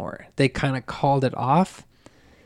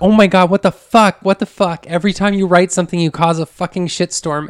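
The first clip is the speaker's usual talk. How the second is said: loud, high furious disbelief; angry yelling; 5 semitones higher, 5 dB louder than usual. Retold or imitated. Husband yelling at his wife over her confession, furious.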